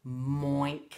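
low "Moink", moo turned into oink; low muddled "Moink"; failed moo. A narrator's reading. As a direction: start on m and end in oink, "Moink". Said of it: "tried to go moo".

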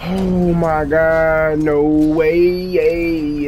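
loud, high, slow amazed cry; can't believe it, cry out; stunned disbelief. Real, not acted. Disbelieving celebration.

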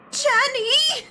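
high, loud cry of the names; indignant shout. Acted. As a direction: holler the names, drawn out and outraged. Calling out indignantly in game dialogue.

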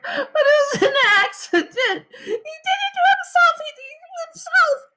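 put-on very high, wailing girl; crying harder. A narrator's reading. Sheila excusing herself after the accident, high crying wail. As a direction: wail the excuse through sobs. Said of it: "Sheila cried harder".

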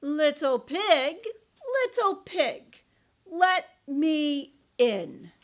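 slow, loud wolf call. Acted. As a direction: drawl it out as the wolf. Wolf at the door.

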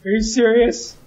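high, loud cry of disbelief; real shrill-pitched gamer outrage; outraged yell. Real, not acted. Outraged at dying in game.

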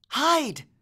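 high, sharp urgent warning; urgent hiss. A narrator's reading. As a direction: hurry them to hide. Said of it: "Annabeth hissed".

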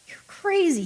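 high, incredulous retort; sharp retort. Acted. Ken retorting to the phone operator, incredulous. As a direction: throw it back at her, disbelieving. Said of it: "he retorted".

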